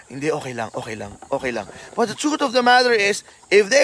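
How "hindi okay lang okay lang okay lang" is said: much lower and softer, brushing it off; pretending to be okay. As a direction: mumble it down, hiding the hurt. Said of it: "Nasaktan ka ba?".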